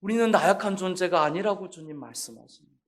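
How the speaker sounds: ordinary talk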